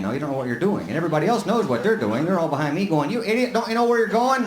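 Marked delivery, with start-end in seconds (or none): 3.1-4.4 higher-pitched jeer at a lost driver; mock heckling. Drivers behind yelling at the lost idiot, mock heckling. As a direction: imitate an exasperated driver behind.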